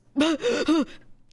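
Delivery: loud, high imitation of sobs; mock sobbing. Friend imitates groom's sister during the vows, mock sobbing. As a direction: burst into exaggerated sobs, mockingly. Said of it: "she starts sobbing".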